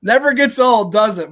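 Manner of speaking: loud, high, delighted reaction; gleeful loud host reaction. Real, not acted. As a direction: relish the clip all over again. Host reacting to replayed rant.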